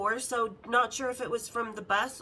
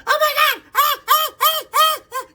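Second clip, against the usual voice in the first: very high, loud mock panic squawks; imitated chicken-like freak-out; 14 dB louder than usual. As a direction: shriek it like a panicking hen, imitating a friend. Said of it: "Just starts cackling like a chicken, freaking the fuck out".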